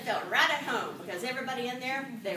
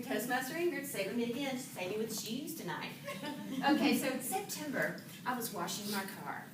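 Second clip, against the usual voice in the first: ordinary talk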